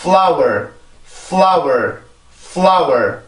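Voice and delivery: "flower" with short F, stressed L, final R; English pronunciation demo. Shown as a demonstration. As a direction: model "flower" as careful, falling "fl-ow-er". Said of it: "그래서 이렇게 발음을 합니다". ("flower"를 짧은 F, 힘준 L, 끝 R로 발음)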